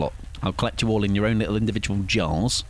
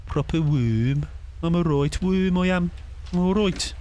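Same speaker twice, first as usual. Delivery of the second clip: Brummie accent; 6 semitones higher than usual. Shown as a demonstration. Streamer carrying on the Brummie accent for chat. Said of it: "what if you said it in a Brummie accent?".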